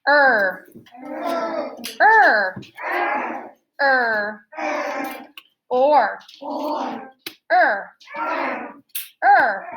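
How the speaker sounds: each sound said alone, slowly and clearly; teacher modelling sounds for class to repeat, sounds held clear; modelling vowel sounds. Shown as a demonstration. Teacher models each vowel sound slowly for repeating. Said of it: "I will say them, and then I would like for you to repeat them".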